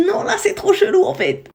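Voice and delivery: high, laughing disbelief; half-laughing, it's getting weird; alarmed laugh. Retold or imitated. To herself in the car, watching the GPS minutes rise, with a laughing high alarm.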